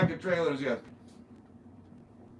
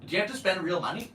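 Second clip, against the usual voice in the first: ordinary talk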